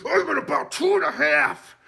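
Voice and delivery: high, loud shriek; squealed taunt. Retold or imitated. Zed taunting Mahoney with a loud shriek.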